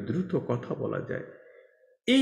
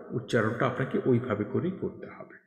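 ordinary delivery

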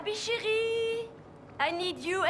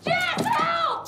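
high, loud desperate call; panicked cry for help; 11 semitones higher, 5 dB louder than usual. Acted. Wounded fighter calling to Jess, panicked cry for help. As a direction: scream for your friend in pain.

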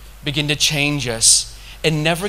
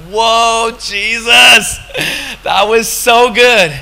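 loud, higher burst of wonder; elated exclamation; 5 semitones higher, 6 dB louder, much slower than usual. Retold or imitated. Retelling his cry to Jesus in the park, loudly elated. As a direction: whoop it out, overjoyed and amazed.

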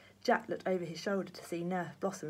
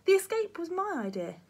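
put-on high young boy's voice; high, earnest boy confessing; 12 semitones higher, 7 dB louder than usual. A narrator's reading. Jack owns up to the matron, high boyish voice.

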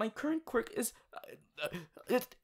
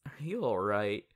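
ordinary delivery

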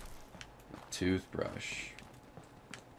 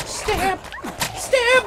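squeaky high battle cry; high-pitched stab; 6 dB louder than usual. Acted. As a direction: shriek it way up high. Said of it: "I can still do the high-pitched ones".

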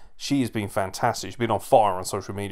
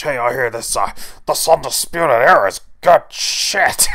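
put-on boss voice, slow and loud on the punchline; Vince McMahon; 8 dB louder than usual. Boss talking to Triple H about a team, put-on voice. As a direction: imitate a big boss, building to a loud finish. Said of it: "it's almost like Vince McMahon sort of".

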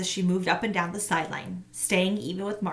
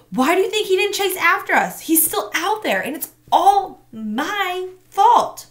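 put-on higher, louder anguished girl; distressed girl; 12 semitones higher, 8 dB louder than usual. A narrator's reading. Girl frets the missing boy is her fault. Tamaya distressed, blames herself.

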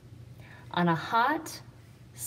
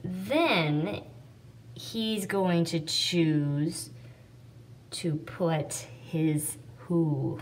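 slow reading with pauses; 2 semitones lower, 3 dB quieter, much slower than usual. Shown as a demonstration. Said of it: "there's a nice pause after that".

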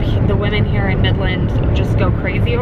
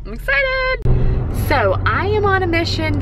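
excited; 10 semitones higher than usual. Real, not acted. Vlogger excited about shopping trip.

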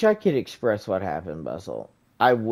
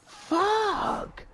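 squeal; 5 dB quieter than usual. Real, not acted. Dying in game.

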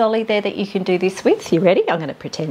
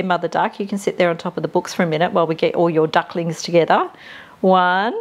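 ordinary talk, nothing marked

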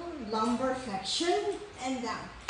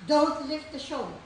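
ordinary delivery

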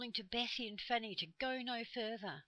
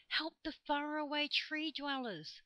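high, forceful stage whisper of leaves; 9 semitones higher, 6 dB louder than usual. A narrator's reading. Leaves plead with listening children, in a high whispering voice. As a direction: whisper urgently as the leaves of a tree. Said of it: "the leaves whispered".

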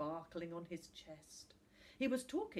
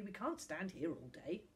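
ordinary delivery, nothing marked

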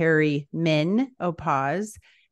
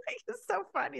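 laughing; 13 semitones higher, 11 dB quieter, faster than usual. Real, not acted. Laughing at the quote.

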